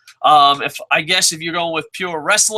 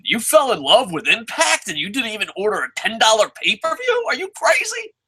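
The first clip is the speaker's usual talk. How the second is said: mock Frozen parody; 6 semitones higher than usual. Parodying a Frozen-style line.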